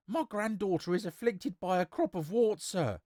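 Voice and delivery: high-pitched peasant woman pleading. Acted.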